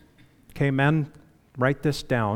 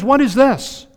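much higher, amazed question; Esau's surprise; 9 semitones higher than usual. Retold or imitated. Esau seeing Jacob's large family, high amazed question. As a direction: marvel at the crowd of children. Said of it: "and he says".

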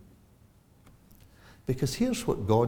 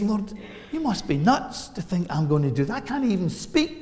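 higher, louder Moses, half-incredulous; protesting Moses; 10 semitones higher, 6 dB louder than usual. Retold or imitated. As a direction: play Moses balking at God's call, raised up. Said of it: "And Moses says".

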